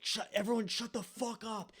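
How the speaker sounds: hammed-up order to the whole party; imitating dad yelling.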